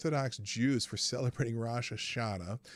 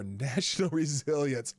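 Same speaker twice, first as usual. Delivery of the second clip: normal talking voice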